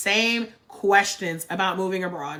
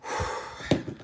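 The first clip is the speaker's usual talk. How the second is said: emotional sigh; 8 dB quieter than usual. Real, not acted. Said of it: "before I get emotional".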